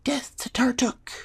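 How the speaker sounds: high, loud gleeful kobold cry; exultant high-pitched battle cheer; kobold's triumphant yell. Acted. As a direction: yell it in a squeaky little voice, triumphant. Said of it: "He grins and yells".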